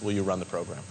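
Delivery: ordinary talk, nothing marked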